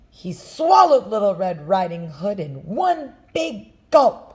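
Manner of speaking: rising to a loud gulp; building to loud. A narrator's reading. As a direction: build and boom the last word.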